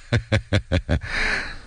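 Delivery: low, soft stuttering chuckle; acted fawning laugh; ingratiating chuckle. A narrator's reading. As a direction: chuckle to please a superior. Magistrate's ingratiating laugh after apology.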